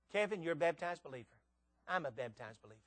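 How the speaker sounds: soft, dropping to a low hush; intimate assurance. Real, not acted. Confides kinship with Kevin.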